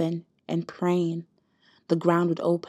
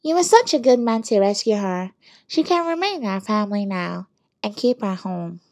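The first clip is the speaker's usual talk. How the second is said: put-on higher, louder nasal older woman; Mama Kofi's nasal voice; 4 semitones higher, 7 dB louder than usual. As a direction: pinch it through the nose, loud and fussy. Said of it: "Mama Kofi's nasally voice".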